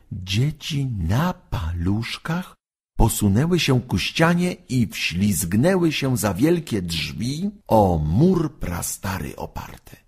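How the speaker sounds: ordinary delivery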